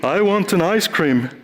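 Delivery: a cute frog shyly asks the troll for ice cream; timid frog. Acted. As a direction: ask for it without much conviction. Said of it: "the troll gets in the way of the frog".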